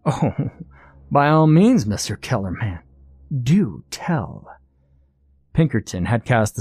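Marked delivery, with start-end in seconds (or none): 0.0-4.5 sarcastic, swooping pitch on the invitation; condescending villain humouring him; mock invitation. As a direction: feign fascination, drawing out the words.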